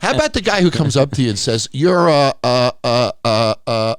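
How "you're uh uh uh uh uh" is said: loud, drawn-out uhs, stumped; groping for a name. Retold or imitated. Stranger coming up to a celebrity, groping for the name. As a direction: imitate someone stuck hunting for a name.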